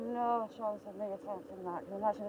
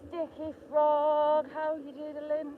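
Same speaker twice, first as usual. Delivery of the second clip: slow, higher, louder call of the name; drawn-out greeting; 6 semitones higher, 6 dB louder, much slower than usual. Real, not acted. Greeting a viewer in the chat by name, drawn out and louder. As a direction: stretch the name out in a bright hello.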